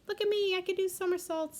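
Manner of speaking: put-on high, bright mouse girl voice; a tiny mouse bragging about her tricks; showing-off little mouse.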